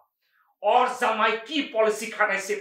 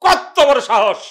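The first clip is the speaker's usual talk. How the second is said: loud, raised, indignant outburst; outraged shout; 4 semitones higher, 9 dB louder, much slower than usual. Acted. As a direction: burst out at their nerve, much louder. Brother's indignation, louder repeat.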